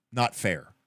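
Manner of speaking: normal talking voice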